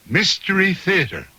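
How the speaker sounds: ordinary delivery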